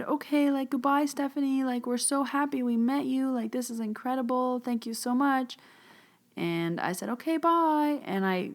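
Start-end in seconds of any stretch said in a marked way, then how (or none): 0.0-5.6 high-pitched imitation of thankful strangers; high, delighted farewell, mimicked; gushing grateful goodbye. 7.1-8.0 high, sing-out retold farewell; quick acted goodbye, pitched way up; chirpy goodbye. Acts out her goodbye.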